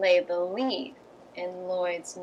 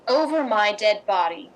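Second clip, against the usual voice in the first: firm, louder refusal; defiant refusal; 9 dB louder than usual. Acted. Karen refusing Eve's demand, defiant and louder. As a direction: shut the blackmailer down flat.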